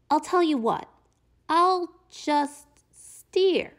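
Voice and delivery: high, drawn-out sly pigeon. Acted. Teacher reading the pigeon offering to just steer. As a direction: coax it out like a sneaky bird.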